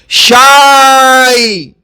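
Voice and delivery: شائی drawn out very slowly; slow model word. Shown as a demonstration. Teacher pronouncing the word for the class slowly. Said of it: "اس کا تلفظ".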